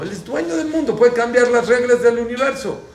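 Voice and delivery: high, loud, emphatic sermon delivery; impassioned preaching. Real, not acted. Rabbi tells listeners that God runs the world, impassioned preaching. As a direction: proclaim it to the whole room.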